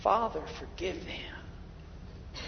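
hushed, very slow and soft; tender hushed prayer; slow whisper. Shown as a demonstration. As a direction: whisper it like a secret. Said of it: "take to heart these words".

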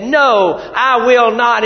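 loud, slow, firm no; firm refusal. Retold or imitated. Preacher voicing Joseph's loud refusal.

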